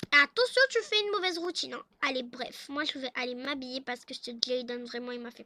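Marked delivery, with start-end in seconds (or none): 0.1-1.8 loud, high warning shout; sharp warning. Acted. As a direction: call a threat after him. Wife warns husband. (avertissement crié, voix haute)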